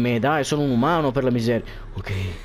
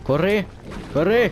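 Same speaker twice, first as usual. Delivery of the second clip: urgent urging; 6 semitones higher, slower than usual. Real, not acted. Urging the character to run.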